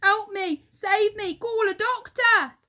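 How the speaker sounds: high, loud cry for help; Mister Twit begging for a doctor; high desperate shout; panicked yell. Acted. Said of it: "yelled Mister Twit".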